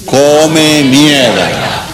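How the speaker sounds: "Come mierda" drawn out very slowly with a laugh; slow drill. Shown as a demonstration. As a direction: stretch out "Come mierda" word by word for the class. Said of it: "When I say three, say the word".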